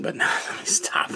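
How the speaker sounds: whispered words through a laugh; giggling whisper. Real, not acted. Vlogger stopping her own cheeky joke, giggling whisper. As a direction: hush yourself mid-giggle.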